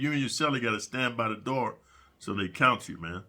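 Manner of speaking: ordinary talk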